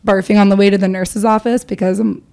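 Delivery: ordinary talk, nothing marked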